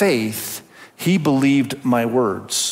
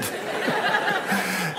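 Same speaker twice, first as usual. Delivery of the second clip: high laugh; 7 dB quieter than usual. Real, not acted. Laughing at his grandson's manners.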